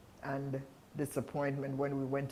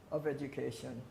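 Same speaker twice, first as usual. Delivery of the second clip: ordinary talk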